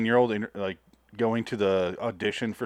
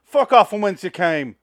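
mock angry British curse; 5 semitones higher, 6 dB louder than usual. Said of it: "he doesn't say".

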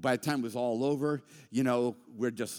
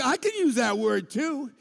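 mock angry comeback; 12 semitones higher, 7 dB louder than usual. Retold heated text reply.

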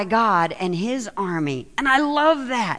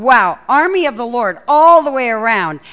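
loud awe; 8 dB louder than usual. Retold or imitated. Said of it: "You're going".

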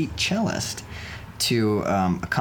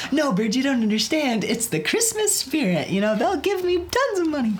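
hammed-up naive enthusiasm, voice pitched way up; high-pitched mock pleading. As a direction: plead the case in a silly high voice. Acts out own naive past plea.

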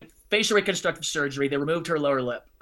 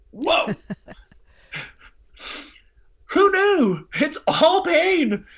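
much higher and louder, slow amazed exclaim; 13 semitones higher, 5 dB louder, much slower than usual. Real, not acted. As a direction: marvel at the discovery out loud. Amazed at the Psalms.

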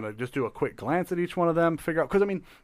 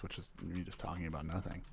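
lower, much softer mumbling; low mumble; 8 semitones lower, 17 dB quieter than usual. Shown as a demonstration. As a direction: drone on low and soft to fill the air. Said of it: "I guess I can just talk like this".

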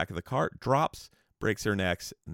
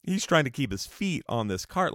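usual speaking voice

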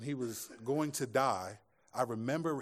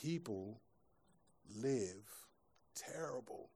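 hushed; 9 dB quieter, slower than usual. Acted. Solemn verdict after long pause.

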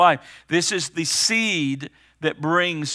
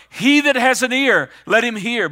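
high, loud preacher's proclamation; raised voice, insistent appeal; urgent cry; 6 semitones higher, 6 dB louder than usual. Retold or imitated. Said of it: "there's an urgent cry today".

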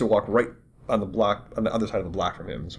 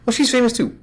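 higher, louder mock wife voice; wife's matter-of-fact reply; 8 dB louder than usual. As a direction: play the wife, chirpy and loud. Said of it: "they'd go".

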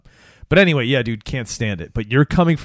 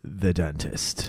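spooky whisper; 7 semitones lower, 10 dB quieter, much slower than usual. Pretend. Spooky whisper about dentist.

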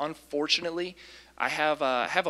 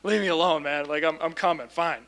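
imitating an exasperated friend, raised voice; louder mock protest that grudgingly gives in; retold annoyed yelling; 4 semitones higher, 5 dB louder than usual. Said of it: "yelling at my friend".

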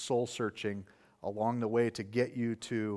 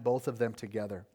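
normal talking voice

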